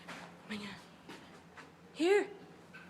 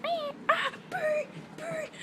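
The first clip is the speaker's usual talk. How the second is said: high mock machine squeal; imitating the tractor; 12 semitones higher, slower than usual. Mimicking the tractor noise for the viewers, high and squeaky. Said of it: "It's like".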